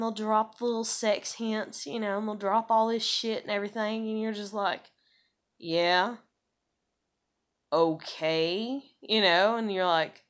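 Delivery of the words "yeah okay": low, drawn-out reluctant replies; unimpressed. Retold or imitated. Woman mimicking replies to men's hints, unimpressed. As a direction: humour him with no enthusiasm, pitched down.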